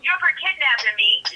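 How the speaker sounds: recounting an indignant pushback; indignant refusal. Retold or imitated. Retells refusing the kidnapper.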